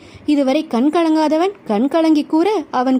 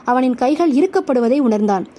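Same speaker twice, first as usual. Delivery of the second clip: usual speaking voice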